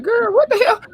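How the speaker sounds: high, shocked disbelief; mock-appalled high exclamation; incredulous reaction. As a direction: squeal it out like you can't believe what you just heard. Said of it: "I be like".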